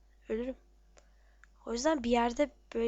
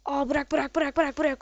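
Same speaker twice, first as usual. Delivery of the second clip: urgent; 5 dB louder than usual. Real, not acted. Urging teammate to drop item.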